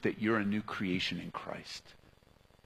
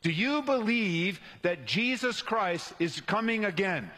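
higher, forceful preaching question; great force; 7 semitones higher than usual. Real, not acted. Preacher asking the church if they believe, with great force. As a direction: put it to the crowd with full force. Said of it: "Say this with great force".

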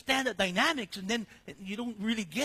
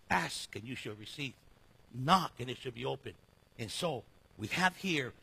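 ordinary talk

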